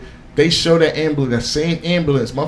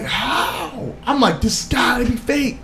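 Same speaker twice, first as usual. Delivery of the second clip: high-pitched, drawn-out mock disbelief; incredulous; 8 semitones higher, 3 dB quieter, slower than usual. Fan retells reacting to the impossible survival, incredulous. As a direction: push the voice up high, as if baffled.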